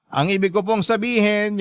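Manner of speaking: ordinary talk, nothing marked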